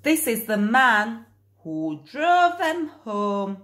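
usual speaking voice

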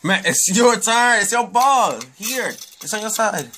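exasperated insistence. Real, not acted. Player telling opponent it's their turn, exasperated yelling. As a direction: insist impatiently, high and mostly loud. Impatiently tells opponent to play.

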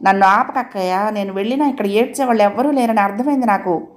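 plain reading voice

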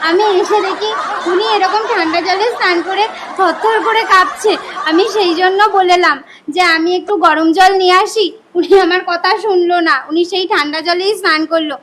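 tearful, sobbing explanation; weepy, melodramatic account; crying. Acted. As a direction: explain it all through sobs. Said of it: "মা এরকম কান্না করছে কেন?".

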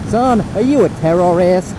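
lightly acted stern questioner; mock interrogator. Imagines being questioned by someone official.

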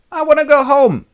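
very high, loud sulky whine; whiny child. Acted. Tiresias whining to the king that he wants to leave. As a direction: whinge like a bored kid. Blind seer sulks like child.

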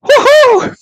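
high, loud whoop of joy; high loud woohoo. Real, not acted. Fan whooping at the goal. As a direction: let out a whoop.

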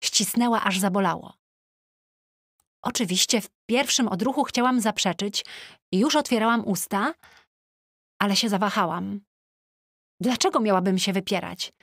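ordinary delivery

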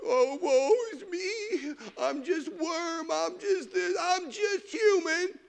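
high, slow, mock-weepy wailing. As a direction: whimper it like a self-pitying believer, hammed-up. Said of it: "They woo-hoo and boo-hooing".

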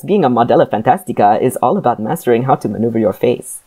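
channeling a suave Spanish actor; lilting Spanish-accented guru. Acted. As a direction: read it in a playful Spanish accent. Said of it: "channel Antonio Banderas".

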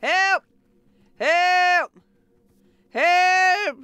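high, loud mock cries; playful distress shouts; calling for help. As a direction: holler for rescue, hamming it up. Calling out for help when trapped.

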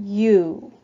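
ordinary talk, nothing marked

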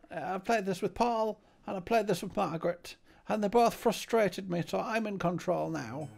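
high, prim little butler voice; Cecil's voice. Acted. Fussy butler greets his master, high voice. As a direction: chirp it up as the fussy servant.

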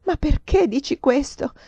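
high, slow, distressed question; tearful plea. A narrator's reading. Countess starting to cry at his insults, tearful plea. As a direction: plead on the edge of tears. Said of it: "E mi misi a piangere".

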